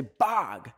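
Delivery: "bog" for bag, vowel pulled wide open to ah; exaggerated American pronunciation. Shown as a demonstration. Canadian teasing Americans, bag overdone as "bog". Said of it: "even though y'all say bog or bag".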